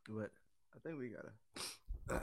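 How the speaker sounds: usual speaking voice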